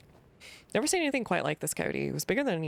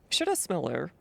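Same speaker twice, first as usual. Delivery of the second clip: ordinary delivery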